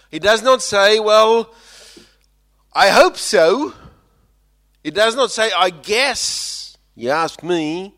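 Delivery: high, loud preacher's cry; preaching at full force. Acted. Preacher contrasting doubt and certainty, full force. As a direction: preach it out with fire.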